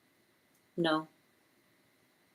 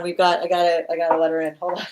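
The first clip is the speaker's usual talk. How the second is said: ordinary delivery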